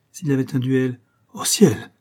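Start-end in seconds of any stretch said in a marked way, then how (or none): 1.3-1.9 horrified cry. A narrator's reading. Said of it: "fit le vicomte épouvanté".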